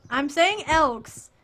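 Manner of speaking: loud, drawn-out insistence; insistent protest. Real, not acted. Laughing protest over teased pronunciation.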